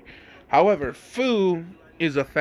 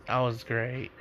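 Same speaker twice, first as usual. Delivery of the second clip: satisfied sigh; 3 semitones lower, 8 dB quieter than usual. Retold or imitated. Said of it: "she's saying".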